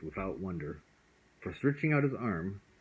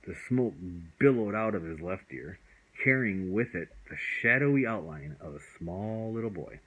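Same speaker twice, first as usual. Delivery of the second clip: ordinary delivery, nothing marked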